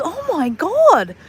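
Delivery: high, drawn-out amazement; retold astonishment. Bar friend hearing of her greeting, imitated astonishment. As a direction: imitate his slow, amazed reaction.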